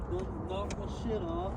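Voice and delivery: hushed whisper, barely voiced. Real, not acted. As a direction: keep it to a whisper so nothing hears. Whispering while hunting at night.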